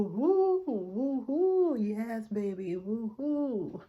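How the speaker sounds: loopy, drawn-out party hoots; feeling good on drugs. Retold or imitated. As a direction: whoop like you're happily drugged up. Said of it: "I was feeling good. I was on that".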